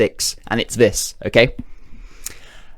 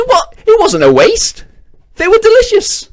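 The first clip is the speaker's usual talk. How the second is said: much higher, louder, offended shriek; indignant high squeal; 5 dB louder than usual. Acted. Pickle-eater squealing back at his friend, indignant and high. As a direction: squeak out his outrage at the idea. Man defending eating the pickles.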